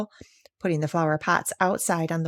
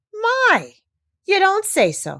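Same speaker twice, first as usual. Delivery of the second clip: much higher, louder astonishment; amazed; 13 semitones higher, 4 dB louder, slower than usual. Acted. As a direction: marvel at it. Doctor amazed.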